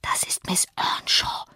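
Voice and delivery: slow, voiceless whisper. A narrator's reading. Boy telling his mother who the girl is, whispering. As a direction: whisper it slowly, as a secret. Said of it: "flüsterte er seiner Mutter zu".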